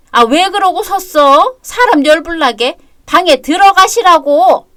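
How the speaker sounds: fed-up mother-in-law nagging loudly; exasperated scolding. Acted. Mother-in-law scolds, orders her inside.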